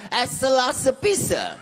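put-on high, slow China shopgirl accent; exaggerated high Chinese-accented English. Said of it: "You know what she replied?".

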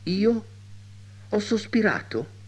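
high-pitched surprised questions; startled denial. A narrator's reading. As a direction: play the astonished maid, voice lifted high. Maid startled by the accusation. (servetta sorpresa, voce acuta)

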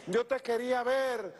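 high, slow mimicked cry; high-pitched retold exclamation; imitated supporter's reply. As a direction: imitate an eager woman in a high, stretched voice. Acts the woman's eager reply.

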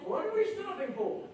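lightly mocking the hurried believer; impatient complaint. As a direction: tease the listeners with their own impatience. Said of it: "Have you ever said that".

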